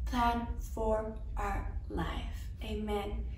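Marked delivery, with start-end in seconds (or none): none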